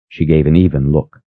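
plain reading voice

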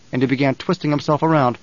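ordinary delivery, nothing marked

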